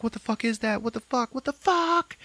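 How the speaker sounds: high, rising frightened exclaims. Retold or imitated. Scared characters staring into the light, high frightened exclaims. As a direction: panic at something unknown. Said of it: "And they're like".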